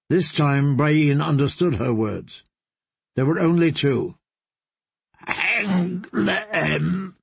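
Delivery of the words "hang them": rasping croak from a ruined throat. A narrator's reading. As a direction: croak it out, broken. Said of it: "she croaked".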